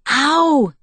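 loud, high cry of pain; sudden outburst after a cut; pained shout. A narrator's reading. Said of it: "he shouted".

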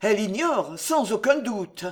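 ordinary delivery

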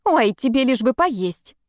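slow, teasing jab; snarky. A narrator's reading. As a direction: needle her brother sarcastically. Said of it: "съязвила Эви". (язвительно)

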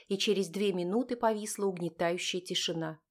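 usual speaking voice

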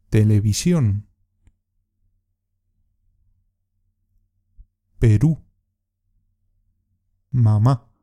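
Televisión to País as separate words, each stressed on its last syllable; pronunciation drill. Shown as a demonstration. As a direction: stress the last syllable: televiSIÓN, peRÚ, maMÁ, expreSIÓN, japoNÉS, caFÉ, caMIÓN, paÍS. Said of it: "Para que puedas practicar la pronunciación".

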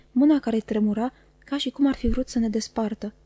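ordinary delivery

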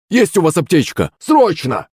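high, urgent call for help; pained, urgent cry. A narrator's reading. Hurt servant asks the cooks for first aid, urgent cry. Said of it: "закричал Захарыч, морщась от боли".

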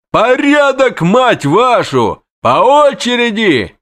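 high, loud bellow at the crowd; bandaged rider yelling at a crowd pushing forward. Acted. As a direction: yell orders over the din.

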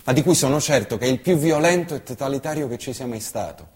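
usual speaking voice

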